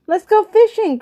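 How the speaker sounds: very high-pitched eager call; excited cry. A narrator's reading. Boy wants to fish, crying out eagerly. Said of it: "cried Benny".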